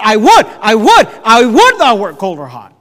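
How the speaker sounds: loud, exasperated outburst; exasperated preacher quoting at full voice; frustrated. Acted. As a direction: cry it out, hammering each repeat. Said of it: "where Jesus is frustrated".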